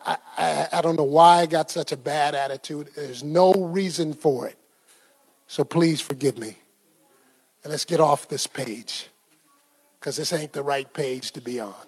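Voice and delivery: soft, low and humbled; contrite apology. Retold or imitated. Husband upstairs apologizing to his wife, contrite. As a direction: drop way down, quietly asking forgiveness.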